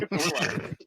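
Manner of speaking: laughing through the words. Real, not acted. Player laughing while telling the story about the coach. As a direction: breaks into real laughter.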